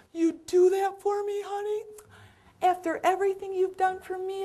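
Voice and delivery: high, sweet, thankful voice; grateful old lady. Retold or imitated. Mabel thanking the caregiver for tucking in the kids, high and sweet. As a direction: thank her like a relieved grandmother.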